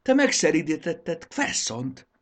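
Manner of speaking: happy shout. A narrator's reading. Raxis cheers Triceps for taming the beast. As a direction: cheer the friend's triumph. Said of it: "kiáltotta boldogan Raxis".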